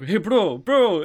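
high, loud bro call; teen bros greeting. Retold or imitated. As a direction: shout it like excited teens. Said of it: "both of them are like".

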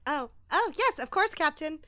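high, bright jump into compliance; eager yes. Acted. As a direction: snap to it like an eager ensign. Ensign snapping to captain's order.

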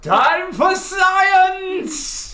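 very high, very slow gleeful proclamation; mad scientist cry. Acted. Mad scientist clone bursting into the scene, high and drawn out. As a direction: announce it like a cackling lab villain.

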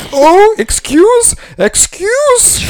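very high, slow, drawn-out squeal; mocking Canadian customer. Bartender mocking a Canadian customer calling him back, very high slow squeal. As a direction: stretch it out like a fussy tourist. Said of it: "the Canadian that has just tipped me".

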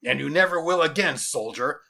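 loud, triumphant officer; gleeful calling out. Acted. General answering his amazed soldier, gleeful. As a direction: call it out with relish. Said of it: "called out the general with glee".

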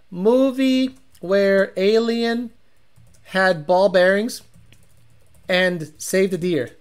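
slow, spelled-out search query. Shown as a demonstration. Reading his own search query slowly to chat. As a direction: type it out word by word, slowly.

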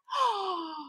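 high, long gasp of amazement; awed intake of breath; delighted gasp. Real, not acted. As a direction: gasp in real wonder. Amazed at the colour pictures.

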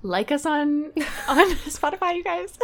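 high-pitched, laughing through the words; laughing plug. Real, not acted. Cracking up over mock plug.

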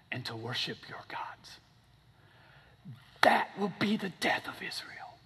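whispered sinister plan; mock villain whisper; scheming whisper. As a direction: hiss the plot like a conspirator. Balaam whispers his scheme.

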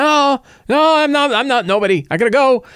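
high, nervous, hurried denial; flustered villain. Retold or imitated. Fleeing villain dodging the adventurers, high and nervous. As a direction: squeak out excuses and bolt.

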